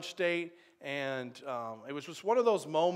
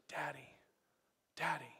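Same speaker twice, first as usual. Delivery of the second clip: put-on child's whisper; 9 dB quieter than usual. Child clinging to his father.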